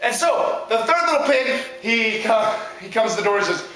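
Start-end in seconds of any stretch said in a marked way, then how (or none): none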